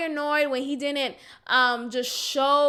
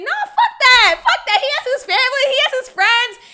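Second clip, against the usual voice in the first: much higher, loud laughing yell; imagined viewers yelling; 10 semitones higher, 8 dB louder than usual. Retold or imitated. Voicing viewers telling her to drop him, laughing mock yell. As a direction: shout it as the outraged audience. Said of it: "some of you guys may be thinking".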